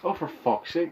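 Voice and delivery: normal talking voice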